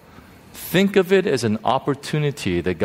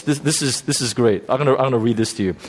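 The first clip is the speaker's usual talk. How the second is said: normal talking voice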